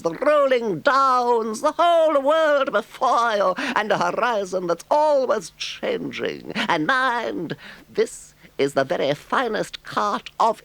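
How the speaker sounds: high, slow, gushing toad voice; boastful Toad. A narrator's reading. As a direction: gush over the visitors. Toad's lines.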